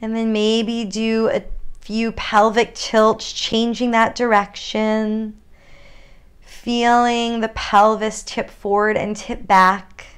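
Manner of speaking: unhurried guided yoga cues with long pauses; calm grounding exercise. Acted. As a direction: lead them gently into their body.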